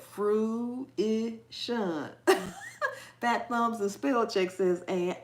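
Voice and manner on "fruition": "Fruition" pulled apart as fru, i, shun; syllable by syllable. Shown as a demonstration. Host showing how she would have to say it, segmented. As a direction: say "Fruition" in separated syllables, ending in a laugh. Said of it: "I would have to say it like that".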